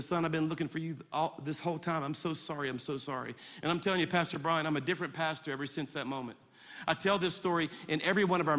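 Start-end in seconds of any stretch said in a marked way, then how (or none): 0.0-3.3 put-on soft, lowered voice to a child; hushed, heartfelt retold apology; tender apology. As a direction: sink gently into being sorry. Father apologises to his found son.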